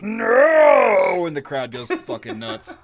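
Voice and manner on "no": loud high mock no; disgusted Skunk Ape cry. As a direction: yelp at your own stink. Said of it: "smells his pit and goes".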